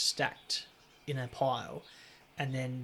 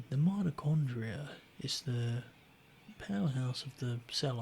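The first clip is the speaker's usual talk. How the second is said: slow monotone lecturer voice. Retold or imitated. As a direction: drone it like a dull biology lecturer. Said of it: "he had a real monotone voice".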